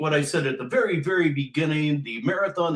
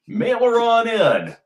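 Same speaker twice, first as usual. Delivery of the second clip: higher, louder call of mail her on in; hammed-up sign-off; 8 semitones higher, 7 dB louder than usual. Shown as a demonstration. Host pitching for donations, hammed-up call. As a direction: sing out mail her on in like an old ad. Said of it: "That's what I'm gonna say".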